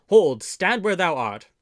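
loud, slow challenge; cried out. A narrator's reading. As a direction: call out a commanding halt, drawn out. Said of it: "cried Robin".

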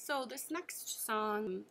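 ordinary talk

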